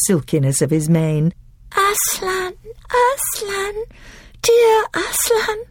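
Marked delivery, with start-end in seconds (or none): 1.8-5.6 high, tearful cry of relief, dear slowed; a little girl sobbing with joy. A narrator's reading. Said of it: "sobbed Lucy".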